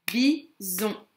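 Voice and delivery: Bison split into two beats, Bi-son; syllable by syllable. Shown as a demonstration. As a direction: count out Bison as Bi, pause, son. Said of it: "on va s'entraîner à compter les syllabes".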